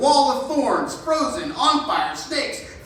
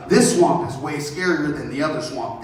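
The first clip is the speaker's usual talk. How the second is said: ordinary talk, nothing marked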